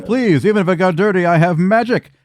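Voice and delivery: high, pompous gnome voice; Nimax in character. Acted. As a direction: show off his magic grandly.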